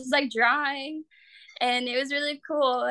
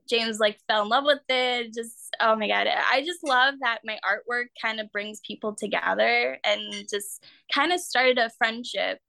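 normal talking voice

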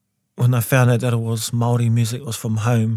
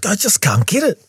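whiny frustration; 9 semitones higher than usual. Retold or imitated. Retells own frustrated reply.

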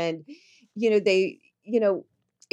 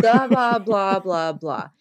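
mocking, louder blah blah trailing off; dismissive blah blah; 7 dB louder, slower than usual. Mocking people who say they know how you feel. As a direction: wave off empty chatter with a sneer.

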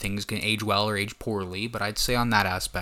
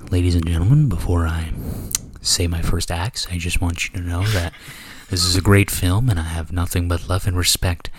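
formal speech; 4 semitones lower, 4 dB louder than usual. Pretend. Mock formal announcement before axing.

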